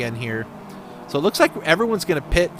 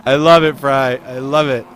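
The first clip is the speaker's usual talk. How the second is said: delighted; 8 dB louder than usual. Real, not acted. Amused at driver's car.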